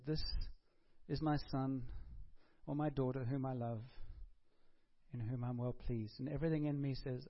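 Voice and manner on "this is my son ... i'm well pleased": soft, intimate blessing, softer; tender father. Retold or imitated. God blessing each listener personally, soft and tender. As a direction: say it gently like an arm around them. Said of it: "put his arm around us and said".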